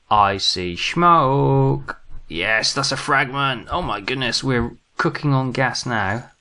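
gasp, then high, slow, drawn-out delight; thrilled, savouring a lucky find; excited discovery. Real, not acted. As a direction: stretch the words out in rising glee. Spots a fragment, delighted.

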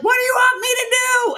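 high, fast shouted reply; shouting cop. Retold or imitated. Cop yelling back at the rider, fast high shout. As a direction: toss back a shouted question. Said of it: "He's like".

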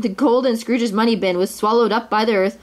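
ordinary delivery

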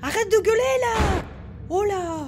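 panicked shouting match; frightened shout. Real, not acted. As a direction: scream back at the screaming thing. Screaming back at a monster.

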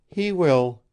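confident. A narrator's reading. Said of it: "she assured him confidently".

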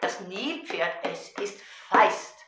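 put-on rhythmic children's poem, chanted to a drum; Gedichtvortrag. Shown as a demonstration. (rhythmisch vorgetragenes Kindergedicht)

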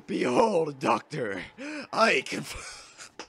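loud, hammed-up proclamation, last words drawn out; playfully pompous announcement; mock grand declaration. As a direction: proclaim it like a pretend showman. Mock grand declaration while playing.

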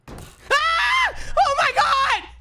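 sudden high shriek of fright; scared scream. Real, not acted. Streamer jump-scared by a horror game, real shriek.

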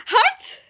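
sharp, loud, high woof; dog bark. Acted. The toy dog Limon barks at the guard, loud high woof.

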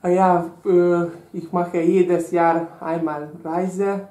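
ordinary delivery

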